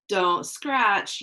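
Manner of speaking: ordinary delivery, nothing marked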